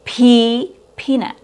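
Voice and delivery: PEA held long and high; long E sound. Shown as a demonstration. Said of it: "It has a long E sound".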